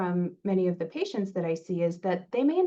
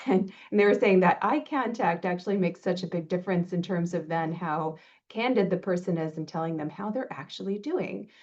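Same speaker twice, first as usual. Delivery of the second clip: ordinary delivery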